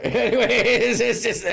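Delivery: talking through real laughter; high, giggly words breaking into laughs; laughing. Real, not acted. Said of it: "as I'm laughing".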